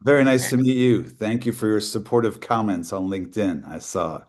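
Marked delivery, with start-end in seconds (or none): none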